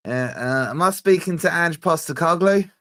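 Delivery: hamming up an Aussie TV producer's greeting; mock Aussie caller. Retelling an Australian caller's phone greeting, mock Aussie accent.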